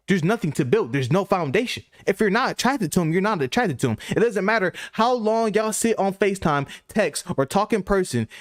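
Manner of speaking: ordinary talk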